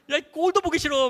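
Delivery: high, loud, hammed-up outburst; retold scolding. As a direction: yell a comic, exasperated telling-off at a friend. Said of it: "막 걔네 막 뭐라 했어요".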